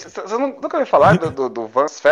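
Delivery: fast, high and loud disbelief; excited. Real, not acted. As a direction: rush it out in disbelief. Amazed friends don't know festival.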